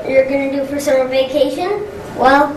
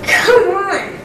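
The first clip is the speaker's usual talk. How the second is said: exasperated "oh, please" tone; 5 semitones higher than usual. Acted. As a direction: protest in exasperation. Fed up with endless school.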